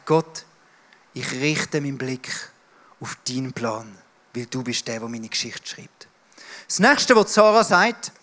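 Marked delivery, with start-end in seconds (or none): 0.0-6.1 much softer, devoted prayer; quiet prayer. Pretend. Believer refocusing on God's plan in prayer, hushed. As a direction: pray it softly, eyes on God.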